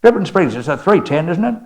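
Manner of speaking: mock Texan calling loudly to the back; a retold shout to a friend.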